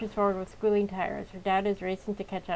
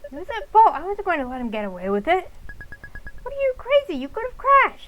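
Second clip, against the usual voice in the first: much louder, higher shouting; family shouting match in car; heated yelling; 10 semitones higher, 7 dB louder than usual. Acted.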